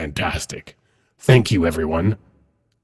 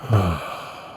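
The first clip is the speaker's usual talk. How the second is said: weary sigh. Acted. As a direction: let out a sigh once he has gone. Alone after Cambion is dismissed.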